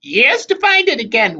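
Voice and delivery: high, loud moan of complaint; moaning hag. A narrator's reading. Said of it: "Wasp moaned".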